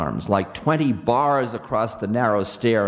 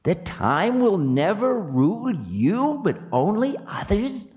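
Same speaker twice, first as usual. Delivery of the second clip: high-pitched imperious Time figure; high voice of offended Time; Kala, scornful; 12 semitones higher than usual. Acted. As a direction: challenge him in a high, piercing pitch. Voices Kala, Time, against Ravana.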